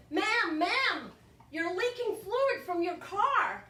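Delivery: high, insistent alert to a stranger; urgent calling out. Retold or imitated. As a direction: call out a warning, drawing out the end. Stranger alerting her to leak.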